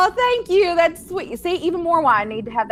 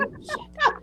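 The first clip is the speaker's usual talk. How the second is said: a high, soft mock groan of the son; son's dismay; 5 dB quieter than usual. Son answering the plea for a grandbaby, high soft mock dismay. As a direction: sigh it out like a cornered son. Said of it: "he's just like".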